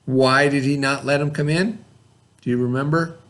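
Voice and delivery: ordinary delivery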